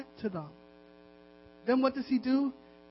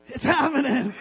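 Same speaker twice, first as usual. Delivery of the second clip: louder playful repeat, chuckling after; playful catchphrase; 3 dB louder than usual. Acted. Pastor joking with fellow pastors, playful and louder. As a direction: say it up and out, for the laugh.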